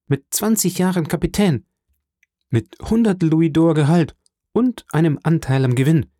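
high, animated announcement; proud excitement. A narrator's reading. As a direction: list the good news with rising delight. Son proudly announces his promotion. (begeistert und stolz)

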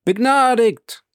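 high, loud joyful cry of the crowd; crowd shout. Acted. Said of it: "rief das Volk mit einem Schrei". (laut und hoch gerufen, wie eine jubelnde Menge)

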